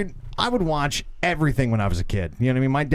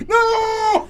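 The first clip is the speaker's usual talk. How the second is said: much higher, louder imitated child's scream; kid screaming no; 5 dB louder than usual. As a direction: yelp it like a terrified child. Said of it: "And she would go".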